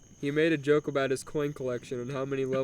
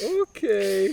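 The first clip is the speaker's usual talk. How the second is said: less enthusiasm; 5 semitones higher, 4 dB louder than usual. Acted. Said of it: "with noticeably less enthusiasm".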